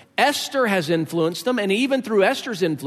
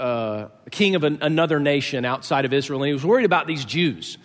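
ordinary talk, nothing marked

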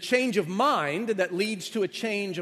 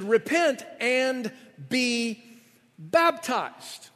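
slow emphatic preaching; 4 semitones higher, much slower than usual. Acted. Quoting Peter, emphatic.

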